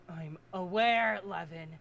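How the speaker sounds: slow, sharp hiss. Acted. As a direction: spit the words out slowly. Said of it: "she hisses".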